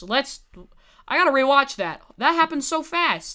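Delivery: usual speaking voice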